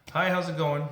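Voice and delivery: friendly greeting. Acted. Clerk greets an irritated customer the other way, friendly.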